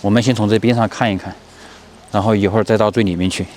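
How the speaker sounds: usual speaking voice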